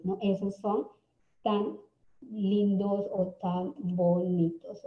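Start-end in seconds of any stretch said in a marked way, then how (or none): none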